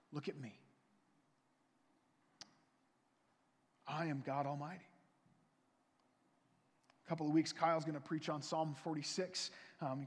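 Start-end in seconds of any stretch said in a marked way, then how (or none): 0.1-4.8 softer, lower reassurance; tender God voice. Acted. God comforting a fearful Abram, soft and tender. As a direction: gently draw his eyes to you.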